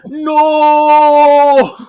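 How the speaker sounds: long, high, very loud yell; mock movie-hero howl; hammed-up horrified cry. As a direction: imitate a dramatic drawn-out scream of protest. [name] cries out as fire shoots.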